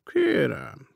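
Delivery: "Kérem" murmured with a touch of mockery; a lightly mocking, soothing "Kérem"; mocking reassurance. A narrator's reading. Dead man soothes the startled millionaire, "Kérem" lightly mocking. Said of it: "gúnyos megnyugtatással dünnyögte".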